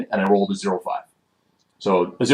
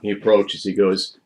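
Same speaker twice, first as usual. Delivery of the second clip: ordinary talk, nothing marked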